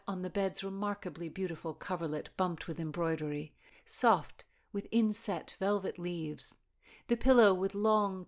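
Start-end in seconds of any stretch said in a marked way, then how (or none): none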